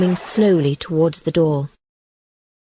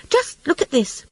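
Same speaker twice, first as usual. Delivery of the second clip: high, raised call to his friend; mild shout; 8 semitones higher than usual. A narrator's reading. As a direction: call out, pointing something out. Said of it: "he shouted".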